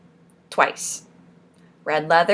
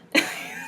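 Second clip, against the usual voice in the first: high-pitched laughing fit; helpless amused laugh; cracking up; 8 dB quieter than usual. Real, not acted. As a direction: burst into high, soft giggles. Cracks up at her own joke.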